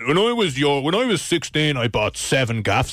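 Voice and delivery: lightly voiced lecturing parent; a hint of a scolding parent; nagging parent. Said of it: "all your parents are like".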